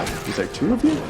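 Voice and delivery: startled, pitch jumps high; surprised high voice. Real, not acted. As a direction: squeak it out in surprise. Surprised by second enemy.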